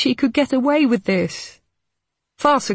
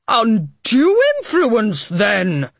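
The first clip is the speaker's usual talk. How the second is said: angry; 4 semitones higher, 5 dB louder, slower than usual. A narrator's reading. Harding grasping at straws.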